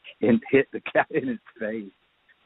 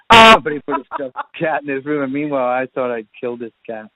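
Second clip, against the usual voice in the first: laughing, much louder and higher; 6 semitones higher, 8 dB louder than usual. Real, not acted. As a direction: burst out through laughter at the joke on yourself. Laughing at the stuffed cat reveal.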